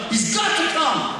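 high, loud insistence; indignant demand. Retold or imitated. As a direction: assistant principal demanding the speaker attend, high and loud. Assistant principal scolds on phone.

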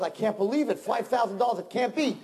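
high, stunned protest; can't believe the price was wasted; husband in shocked disbelief. Acted. Peasant stunned.

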